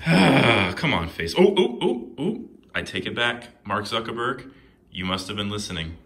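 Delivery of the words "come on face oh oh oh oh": loud strained come on; frustrated push. Real, not acted. Fighting the staple, loud frustrated effort. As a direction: shove through it with a yell.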